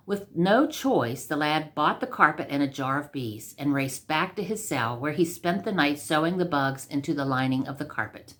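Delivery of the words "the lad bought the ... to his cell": ordinary delivery, nothing marked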